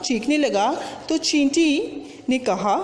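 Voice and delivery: ordinary talk, nothing marked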